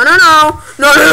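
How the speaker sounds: ordinary delivery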